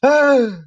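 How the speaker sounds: loud, high gasp; shocked, strangled intake of breath. A narrator's reading. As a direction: gasp in horror as if choking. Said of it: "giving out a series of strangled gasps".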